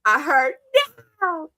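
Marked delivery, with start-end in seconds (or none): 1.2-1.5 crying no; mock wail. Lisa pleads with the uncle, a crying mock wail.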